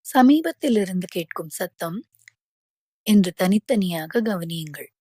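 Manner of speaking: usual speaking voice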